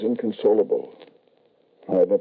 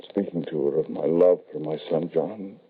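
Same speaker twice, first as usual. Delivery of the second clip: usual speaking voice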